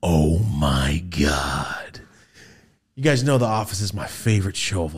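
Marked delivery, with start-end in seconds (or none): 0.0-2.0 slow, breathless whispered oh my God; stunned whisper. Retold or imitated. Spotting the actor at the door, whispering in shock. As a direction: gasp it out in a slow awed whisper. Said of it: "I go".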